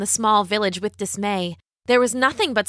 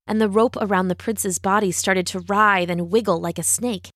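ordinary delivery, nothing marked

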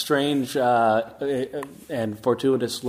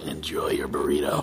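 Castiel voice; 12 semitones lower than usual. Pretend. Asked for a Castiel read of the sign-off, low and grave. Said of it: "Now, now do the Castiel".

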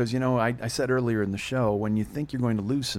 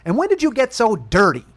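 high, loud mom imitation; mock scolding mother; 10 semitones higher, 9 dB louder than usual. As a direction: imitate a scandalized mother telling off her son. Mother scolding son, acted.

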